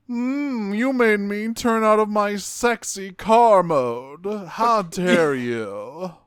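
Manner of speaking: high, slow campy sulk; huffy diva robot voice, drawn out; campy Trax voice. Retold or imitated. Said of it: "he goes".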